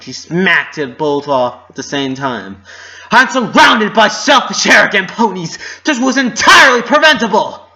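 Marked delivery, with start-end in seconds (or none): 3.1-7.6 high, loud, bitter outburst; loud furious voice. Acted. Twilight raging at her friends, loud and high. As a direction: shout it in disgust at everyone. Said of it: "Twilight's loud voice".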